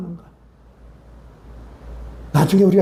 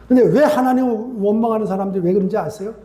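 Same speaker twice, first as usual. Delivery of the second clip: usual speaking voice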